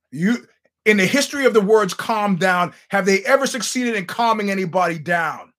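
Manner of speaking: loud, emphatic mock outrage; indignant rant. Comic retelling his tirade at an armed heckler, indignant. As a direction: argue it loudly, like a furious comeback.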